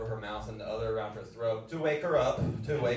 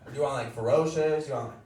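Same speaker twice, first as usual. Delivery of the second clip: mock ferocious; 6 semitones higher, 6 dB louder than usual. Mock offer, loud.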